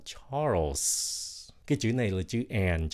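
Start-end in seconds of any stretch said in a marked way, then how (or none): 0.1-1.1 Charles ending on a clear hissed s; final s sound. Shown as a demonstration. Teacher reminds learners to keep the final s. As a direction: finish Charles with the s. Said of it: "Nhớ xì nữa".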